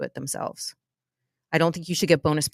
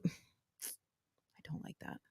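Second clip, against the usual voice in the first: slow whispered aside; 21 dB quieter, much slower than usual. Real, not acted. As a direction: whisper it slowly, disapproving. Aside while reading rules.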